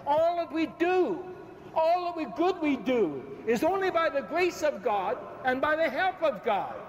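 ordinary talk, nothing marked

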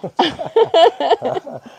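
high, loud laugh bursting out; burst of laughter. Real, not acted. Laughing after a scare with the squirrels. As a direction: laugh out loud, caught off guard.

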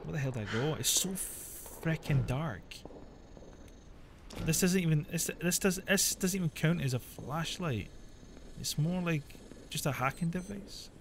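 soft, hushed creeping commentary. Real, not acted. Creeping through dark game area.

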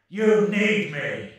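possessive insistence. Acted. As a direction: lay claim to him. Controlling voice insists.

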